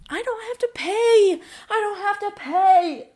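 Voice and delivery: high, loud, gleeful; excited squeal. Retold or imitated. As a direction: squeal with joy. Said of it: "And I was like".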